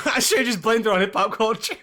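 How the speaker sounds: chuckling through the line; laughing. Real, not acted. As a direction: giggle at your own excuse. Laughing at own excuse.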